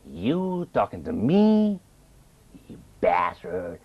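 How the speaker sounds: high, slow badly dubbed kung fu movie voice, ending in a high cackle; dubbed Chinese movie accent. Imitating a dubbed Chinese movie to the host, high Americanized dub voice. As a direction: ham up a dubbed martial arts villain. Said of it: "Americanized accent".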